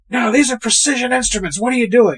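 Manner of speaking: high, exasperated imitated scolding; hammed-up fussy outrage; mock irate. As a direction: act out an indignant coach. Retells irate coach scolding her.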